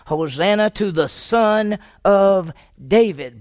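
loud, slow proclamation; crying out praise. Retold or imitated. Multitudes hailing Jesus entering the city, loud slow acclaim. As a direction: draw it out like an acclaiming crowd. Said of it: "were crying out".

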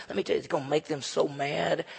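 ordinary talk

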